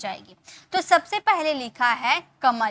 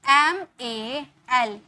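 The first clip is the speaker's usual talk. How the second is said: letters spaced far apart; slow dictation; slow spelling out; much slower than usual. Shown as a demonstration. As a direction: dictate the last letters slowly. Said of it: "इसीलिए इसे हम लिखेंगे".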